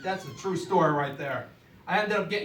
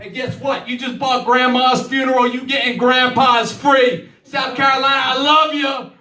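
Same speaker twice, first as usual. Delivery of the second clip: loud, higher sales-pitch shout; hyped shouting pitch; 7 semitones higher, 11 dB louder than usual. Real, not acted. Comic closing his act to the crowd, loud, higher hyped shout. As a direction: sell it to the whole room at full volume.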